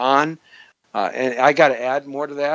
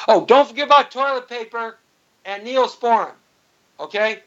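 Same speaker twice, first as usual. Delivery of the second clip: higher-pitched mock urgent check; pretend phone call; 9 semitones higher than usual. Host pretends to phone about survival supplies, raised voice.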